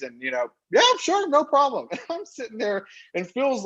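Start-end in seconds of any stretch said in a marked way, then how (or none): 0.7-1.9 much higher, louder, overeager promise; hammed-up eager yes. Promising the Reebok executives everything, high and hammed-up. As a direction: squeak it out like a too-keen yes-man.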